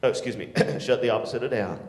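ordinary delivery

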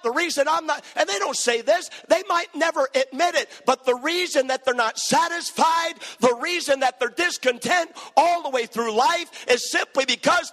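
high, driving, insistent sermon delivery; full-force preaching. Acted. Preacher urging parents to build a relationship, impassioned preaching. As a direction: preach it relentlessly, punching each phrase.

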